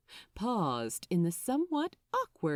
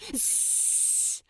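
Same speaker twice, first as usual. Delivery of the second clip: sharp shushing hiss; hushing hiss; 5 dB quieter than usual. Acted. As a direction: hush him at once. Crowley hushing.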